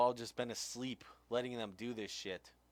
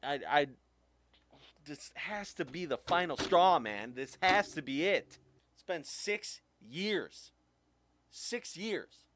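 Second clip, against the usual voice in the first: raised, higher voice that breaks with frustration; exasperated outburst; 5 semitones higher, 5 dB louder, slower than usual. Real, not acted. Ranting to viewers about the files, exasperated and loud. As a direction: stretch six years out, fed up.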